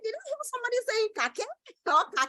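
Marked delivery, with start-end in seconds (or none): 1.2-1.5 "kake" said kah-keh; wrong pronunciation. Shown as a demonstration. Teacher quotes an imagined pupil misreading cake, "kake" with final E sounded. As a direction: give "kake" an extra syllable for the silent E. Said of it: "No, you won't do that".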